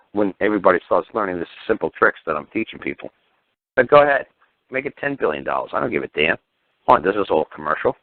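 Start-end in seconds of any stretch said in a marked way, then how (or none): 3.8-5.7 defiant dare. Acted. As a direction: throw it at the judge like a dare. Daring the judge.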